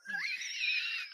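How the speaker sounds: very high shrieking laugh; squealing laugh. Real, not acted. Host cracking up at his own outburst. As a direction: let out a wild shriek of a laugh. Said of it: "I don't even know why I'm doing that".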